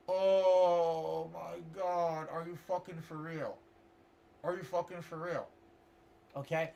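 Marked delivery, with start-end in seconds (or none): 0.1-5.5 slow, soft, dragged-out disbelief; stunned disbelief. Retold or imitated. Reacting to the counselor's advice, soft slow disbelief. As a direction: let it sink in slowly, quietly. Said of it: "I'm like".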